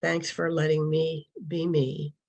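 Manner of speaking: usual speaking voice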